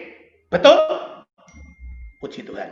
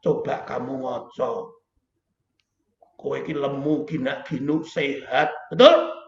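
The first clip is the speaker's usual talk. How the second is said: God speaking; 6 semitones lower than usual. Retold or imitated. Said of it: "Tuhan tuh ngejak guyon".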